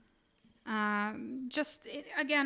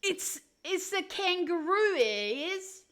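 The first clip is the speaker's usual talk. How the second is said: loud, high, stumbling Aussie attempt; badly faked Australian accent; botched Australian accent; 5 semitones higher, 5 dB louder than usual. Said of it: "It sounded like I had a stroke".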